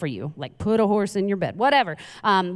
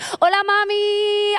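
mock Spanish accent; 13 semitones higher, 4 dB louder, slower than usual. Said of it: "And I was like".

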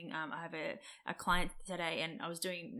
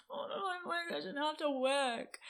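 high, whining put-on voice; mock whiny self; 9 semitones higher than usual. Mimicking her own sulky self to her partner, whiny. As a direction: whine it like a sulky child. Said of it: "I'm like".

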